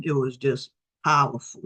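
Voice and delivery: ordinary talk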